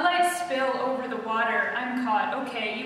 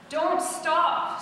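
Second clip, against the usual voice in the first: raised call across the water; Saul yelling; 6 semitones higher, 4 dB louder than usual. A narrator's reading. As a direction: call out to keep her going. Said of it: "Saul yells".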